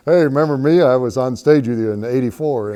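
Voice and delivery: loud, eager mock fan calling out; eager fan greeting. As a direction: play the fan who's thrilled to be remembered. Fans greeting him eagerly.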